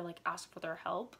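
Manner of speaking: ordinary talk, nothing marked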